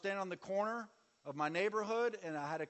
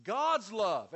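normal talking voice

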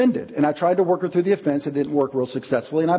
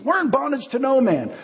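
mock-haughty, much higher; proud denial; 9 semitones higher than usual. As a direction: puff up and deny it again. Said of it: "This is what they said to Jesus".

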